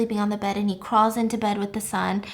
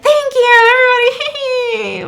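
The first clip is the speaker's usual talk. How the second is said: put-on gushing girlfriend; 11 dB louder than usual. Choi's girlfriend thanks the guests, high showy squeal. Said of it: "She's like".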